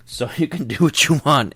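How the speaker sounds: laughing through the words; a real laugh mid-line. Real, not acted. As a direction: chuckle as you say it. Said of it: "you have to use that voice".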